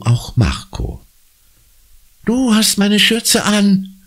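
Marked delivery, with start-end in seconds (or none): 2.2-4.0 put-on high, slow shocked cry of a girl; horrified shriek. A narrator's reading. Conny spots her costume on Marco, shrieking in horror. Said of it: "kreischt Conny entsetzt".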